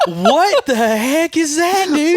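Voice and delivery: loud, high, drawn-out retold shock; slow, alarmed outburst, half amused; startled disbelief. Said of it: "and it scares me".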